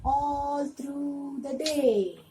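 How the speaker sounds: slow, sing-song poem reading; reading to a toddler. Shown as a demonstration. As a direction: read it slowly for little kids. Teacher reads poem slowly to kids.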